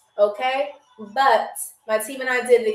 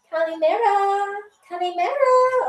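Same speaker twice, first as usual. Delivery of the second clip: high, singsong feminine hello, swooping higher; woman's greeting; 7 semitones higher than usual. Shown as a demonstration. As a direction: greet a hotel crush in a high girly lilt. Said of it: "Or if you're a dude".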